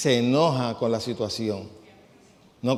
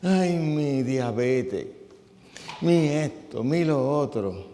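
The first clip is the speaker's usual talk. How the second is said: drawn-out, whiny complaint; slow, put-on woe-is-me moan; mock self-pity; slower than usual. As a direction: imitate a sufferer fishing for pity. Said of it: "ponen una cara de pena así, para que tú le cojas pena".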